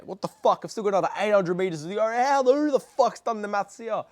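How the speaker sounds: retold exasperation, high and building; exasperated outburst. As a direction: act out disbelief, pitch climbing as you protest. Acting out outrage at extra distance.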